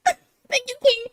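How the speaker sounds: high, sobbing-edged thank you; teary mock gratitude. Podcaster voicing the insulted girlfriend thanking him, high and tearful. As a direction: gush through fake tears, up high.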